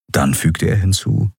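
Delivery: plain reading voice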